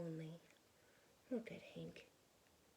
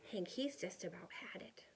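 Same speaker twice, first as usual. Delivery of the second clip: ordinary delivery, nothing marked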